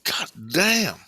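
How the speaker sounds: high-pitched, drawn-out stunned curse; retold yelp of pain on a curse; pained exclamation. Dreamer reacts to his crushed toes, pained exclamation.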